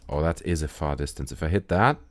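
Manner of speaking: ordinary talk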